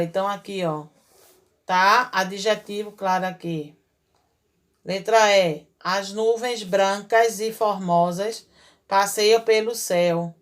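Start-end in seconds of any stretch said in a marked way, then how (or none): 5.8-10.3 unhurried, clear dictation pace; slow reading aloud. Shown as a demonstration. Teacher reads the second sentence slowly for the pupils. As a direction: read it slowly so the class can follow. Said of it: "As nuvens são como?".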